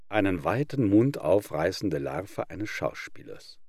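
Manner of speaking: ordinary delivery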